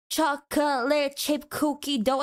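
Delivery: "chocolate chip cookie dough" laboured out syllable by syllable; mock a slow customer dragging "chocolate chip cookie dough"; holding up the line. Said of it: "and holding up the line".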